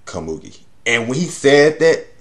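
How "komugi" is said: soft tender whisper of a name. Retold or imitated. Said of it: "the way the voice actor said it, it was just beautiful".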